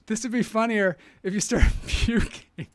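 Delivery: real laugh breaking into the words. Real, not acted. Comedian joking about the pumpkin film, laughing. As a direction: crack up while talking. Cracks up at own pumpkin joke.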